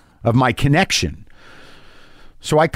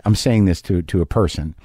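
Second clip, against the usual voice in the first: ordinary talk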